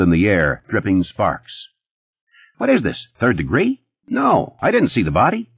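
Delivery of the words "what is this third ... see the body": high, jumpy denial; Eddie protesting. A narrator's reading. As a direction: squeak out an indignant no. Narrator voices Eddie, defensive.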